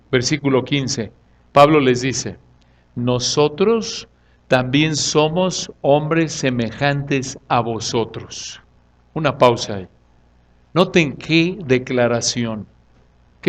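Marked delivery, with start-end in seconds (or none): 2.9-8.6 slow, softened, spaced-out words; solemn declaration. Acted. Preacher voices Paul addressing the crowd, slow solemn declaration. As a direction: declare it humbly, one measured phrase at a time. Voicing Paul's humble declaration.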